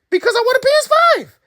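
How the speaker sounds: very high, fast whiny squeal; hammed-up high-pitched demand; mock tantrum. Childlike demand for a console.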